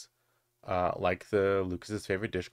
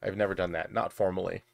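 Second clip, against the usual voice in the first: ordinary talk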